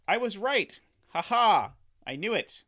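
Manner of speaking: high and loud, crowing with a laugh; real delight at being right; triumphant. Real, not acted. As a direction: gloat that the hunch paid off. Prediction proves right, triumphant.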